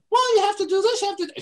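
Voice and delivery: put-on high, eager child voice; little girl voice. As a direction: chirp it like a young girl. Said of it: "She says".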